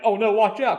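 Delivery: high, loud alarmed warning; urgent warning shout. Retold or imitated. Cotton warns Christine.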